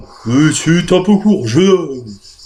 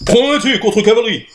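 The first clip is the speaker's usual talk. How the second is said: military; 6 semitones higher than usual. Acted.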